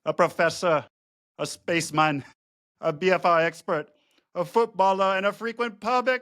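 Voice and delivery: high, lilting mock Welsh accent; sing-song Welsh accent, played for laughs. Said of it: "I'm gonna use my Welsh accent".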